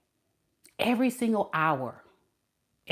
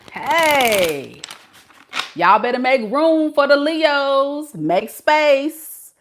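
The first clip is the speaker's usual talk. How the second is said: hype call; 8 semitones higher, 5 dB louder, slower than usual. Acted. Hyping the Leos in the live.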